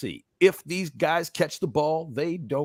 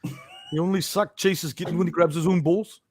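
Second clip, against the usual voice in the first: higher, put-on impression voice; mimicking someone; 5 semitones higher than usual. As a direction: do a comic take-off of someone. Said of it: "He sounds just like him".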